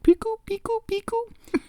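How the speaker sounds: very high squirrel squeaks. Acted. Friend retells a cartoon squirrel's chatter, high squirrel voice. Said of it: "And the squirrel's like".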